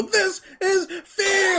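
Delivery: high, drawn-out hype scream. Pretend. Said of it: "Like, scream that shit".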